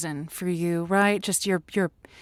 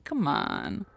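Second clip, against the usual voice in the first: ordinary talk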